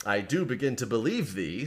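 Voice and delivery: ordinary delivery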